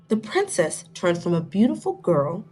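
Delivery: ordinary delivery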